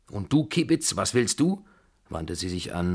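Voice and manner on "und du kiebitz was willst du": higher, indignant questioning; scornful challenge. A narrator's reading. Grandmother rounding on Mademoiselle Blanche, scornful challenge. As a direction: turn crossly on the next offender. Babuschka turns scolding on Blanche.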